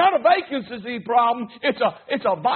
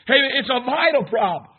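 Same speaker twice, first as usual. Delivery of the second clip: normal talking voice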